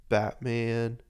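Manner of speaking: ordinary talk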